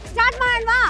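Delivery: ordinary talk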